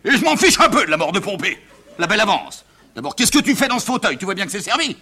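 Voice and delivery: high-pitched irritated father; exasperated outburst. Acted. Father dismisses son's roles.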